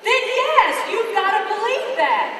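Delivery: loud, high, emphatic cheerleading; rousing shout. Real, not acted. Coach urging students to dream big, loud rousing shout. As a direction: pump the crowd up, loud.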